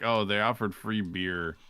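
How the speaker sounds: normal talking voice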